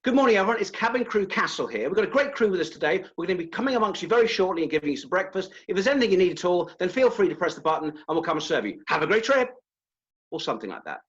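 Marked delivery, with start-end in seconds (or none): 0.0-9.5 high, loud, fast and over-friendly; exaggerated chirpy flight attendant, no pauses; cabin crew announcement. Shown as a demonstration. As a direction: gush it out quickly with sing-song variety. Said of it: "you'll hear someone from the cabin crew".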